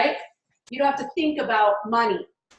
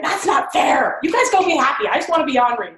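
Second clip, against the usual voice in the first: petulant protest; 3 semitones higher, 5 dB louder than usual. Retold or imitated. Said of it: "I used to be like".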